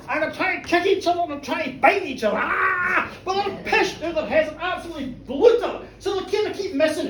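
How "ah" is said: brawling roar. Acted. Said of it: "they're roaring each other".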